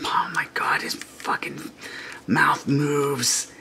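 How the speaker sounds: soft unvoiced whisper of awe; real hushed amazement; awed whisper. Real, not acted. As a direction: breathe it in disbelief.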